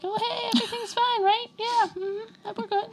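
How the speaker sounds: high, breezy fake cheer; fake cheerful. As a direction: brush it off brightly. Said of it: "be like".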